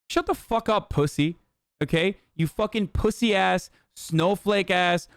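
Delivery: ordinary talk, nothing marked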